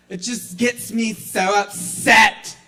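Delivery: skater dude. Acted. Lightly voiced skater ranting about ripped jeans.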